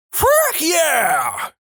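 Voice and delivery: very high, drawn-out holler; screamed catchphrase. Acted. Someone chimes in whenever Matt is mentioned, screaming. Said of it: "someone always adds a".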